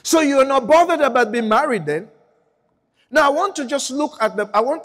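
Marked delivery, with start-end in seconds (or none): 0.0-2.1 pointed, teasing question; mock challenge. Preacher needling the congregation with a loud question.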